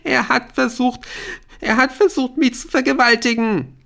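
high, loud tearful outburst. A narrator's reading. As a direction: wail the accusation out. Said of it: "Aisha heulte lauter".

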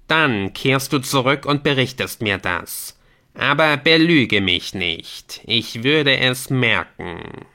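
measured warning, slowing toward the end; stern game messenger. Acted. Messenger warns player against lying, stern. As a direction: warn slowly and imperiously.

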